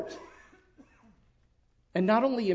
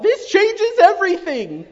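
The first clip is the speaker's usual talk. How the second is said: much higher and louder, cracking outcry; anguished cry; 11 dB louder than usual. Retold or imitated. Father recalling his anguished reply to his wife, much higher and louder. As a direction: cry it out in despair. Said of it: "I cried out".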